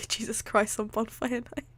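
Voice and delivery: whispered through giggles; breathless laughing. Real, not acted. Reader tickled by the phrase, laughing under the breath. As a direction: repeat it weakly with no voice left from laughing.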